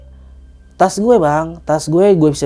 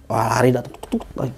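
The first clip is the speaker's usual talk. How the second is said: ordinary talk, nothing marked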